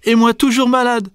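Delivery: put-on whining baby hippo. A narrator's reading. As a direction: whine it high as a poorly little one. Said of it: "gémit Bébé Hippo". (bébé qui gémit, voix aiguë)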